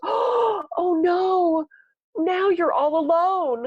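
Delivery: high, mock-pitying gasp of sympathy. Client pitying the therapist over her dad's death, mock gasp. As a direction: imitate a gushing client's pity, high up.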